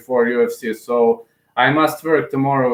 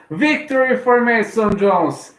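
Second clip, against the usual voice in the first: much higher, slow and drawn out; thrilled victory announcement; excited victory call; 9 semitones higher, slower than usual. Real, not acted. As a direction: announce the win like a ring announcer. Announces the win live.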